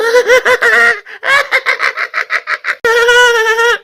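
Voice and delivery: very high, loud cackling hyena laugh. Pretend. Salesman imitates the hyena, loud shrieking cackle. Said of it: "Ou alors la hyène".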